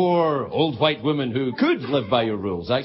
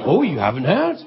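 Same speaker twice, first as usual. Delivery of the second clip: ordinary delivery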